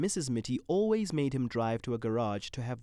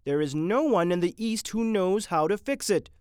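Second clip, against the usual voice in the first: high, loud alarmed shout; shouting intern; 7 semitones higher, 7 dB louder than usual. A narrator's reading. Intern shouting that the machine fails, loud. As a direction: shout the alarm across the room. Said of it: "shouted an intern".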